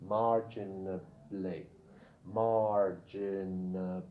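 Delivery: "marginally" drawn out slowly, each syllable held; low, slow teaching demo of "marginally". Shown as a demonstration. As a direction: sound out "marginally" very slowly for learners. Teacher models word syllable by syllable.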